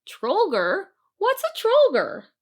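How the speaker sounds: high, loud baffled question; can't believe the word; puzzled centaur. Acted.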